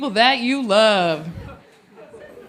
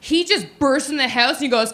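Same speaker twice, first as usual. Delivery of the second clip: ordinary delivery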